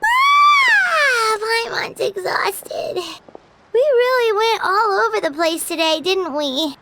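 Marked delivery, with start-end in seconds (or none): 0.0-1.4 tired groan. Acted. Paimon tired at the inn.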